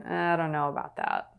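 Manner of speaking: lightly voiced unsure patient; a hint of a hesitant, reluctant reply; doubtful patient. Patient unsure about the recommendations, lightly acted doubtful reply. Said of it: "They're saying".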